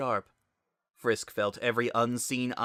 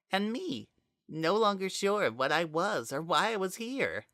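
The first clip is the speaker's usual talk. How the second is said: higher, eerie possessed voice speaking through Azriel; a dark spirit wearing a friend's voice, higher; possessed Kara voice; 6 semitones higher than usual. A narrator's reading. Kara confronts Frisk, speaking as eerie higher voice.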